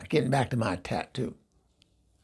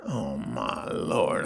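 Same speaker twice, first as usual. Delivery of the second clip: much lower, very slow, softer; slow low dread; 9 semitones lower, 4 dB quieter, much slower than usual. Retold or imitated. [name] sees the tattoo wink, low and dreading. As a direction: let the dread sink in. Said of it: "I went".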